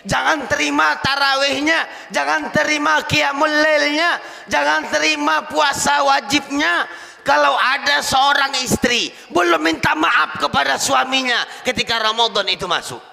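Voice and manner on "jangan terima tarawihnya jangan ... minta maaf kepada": loud, high proclamation; forceful preaching. Acted. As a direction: call it out like a warning from the pulpit. (seruan lantang berkhotbah)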